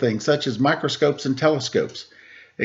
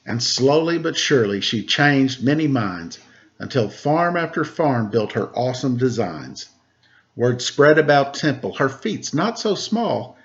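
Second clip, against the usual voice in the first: put-on reading to children. Teacher reading a Temple Grandin picture book to kids, lively storybook voice. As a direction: read the rhymes aloud to a class of kids.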